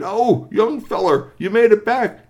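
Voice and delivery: loud, high character voice; booming-loud, high-pitched delight; excited greeting. Acted. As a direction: hail the youngster with gusto. Marvel Raptrack spots GP, hails him.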